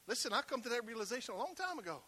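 usual speaking voice

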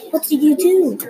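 a mock yell of protest; mild narrated scream. A narrator's reading. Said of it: "John screamed".

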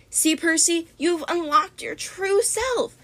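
high, slow, silky witch voice; slowly purring over her captive; gloating sorceress. A narrator's reading. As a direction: savour every word like a witch. Cece gloating over transformed Percy.

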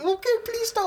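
high-pitched mock Indian accent, pleading; hammed-up Indian accent begging them to stop. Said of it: "We did Indian accents".

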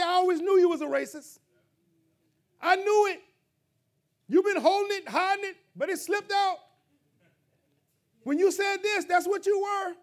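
loud, high accusing voice mimicking a quick judge; accusing outburst. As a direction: throw the accusation like a gotcha. Mocking a harsh accuser.

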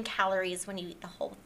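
quick whispered confession; whispered aside. Acted. Guest confides to the listeners in a quick whisper. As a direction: rush it out under your breath.

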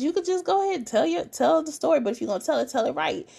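high, quick sassy retort; mock Monique snapping. Retelling the table argument, Monique's high snippy voice. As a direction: mimic her telling him off.

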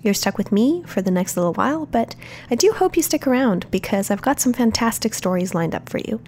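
normal talking voice

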